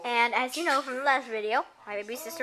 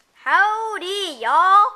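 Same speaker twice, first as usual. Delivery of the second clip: loud high cowboy howdy; cowboy greeting; 7 semitones higher, 11 dB louder, much slower than usual. Pretend. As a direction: shout it like a cowboy. Voicing baby sister in hat.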